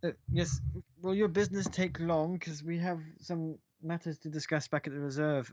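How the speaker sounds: a reserve member pressing for business; lightly voiced impatient official; official guard voice. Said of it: "a member of the Cobalt Reserve going".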